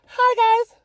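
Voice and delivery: very high, loud squeaky hello; high-pitched. Retold or imitated. Former bubbly kid saying hi to people, high-pitched. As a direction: imitate your younger self's high-pitched greeting. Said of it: "High-pitched voice. Wasn't even that low. It was like".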